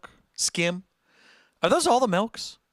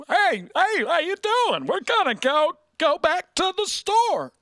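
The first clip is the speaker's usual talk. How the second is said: much higher, twangy small-town deputy impression, slowing at the end; Barney Fife; 14 semitones higher, 3 dB louder, slower than usual. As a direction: squeak it out like a goofy TV deputy. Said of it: "Yeah, Barney Fife".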